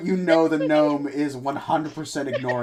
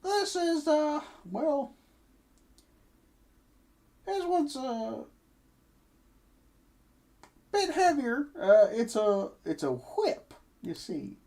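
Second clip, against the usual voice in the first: higher, friendly merchant voice; chirpy shopkeeper pitching wares; 12 semitones higher than usual. Acted. GM voicing shopkeeper.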